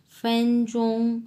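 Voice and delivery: drawn-out flat first-tone ফ্যানচোং; Chinese first tones. Shown as a demonstration. Teaching students a Chinese word: slow, level tones. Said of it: "দুটাই এক number tone".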